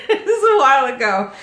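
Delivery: high, loud, fast laughing admission. Real, not acted. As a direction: laugh through the words after your own joke. Laughing at own age joke.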